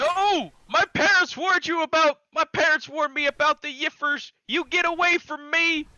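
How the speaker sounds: high, loud, panicked yelling; panicked Southern Harry. Retold or imitated. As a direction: shriek it like a terrified Southern boy. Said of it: "Southern Harry?".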